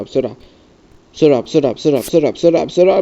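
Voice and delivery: ordinary delivery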